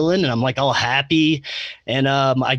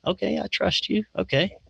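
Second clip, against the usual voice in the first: soft, higher-pitched, cautious agreement; tentative acceptance; 7 semitones higher, 5 dB quieter than usual. Retold or imitated. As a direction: give in gently, a little unsure. Acts out tentatively trusting the voice.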